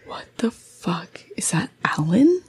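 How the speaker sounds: hushed unvoiced whisper; husband whispering at the window. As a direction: whisper it so the figure won't hear. Husband peering at figure outside.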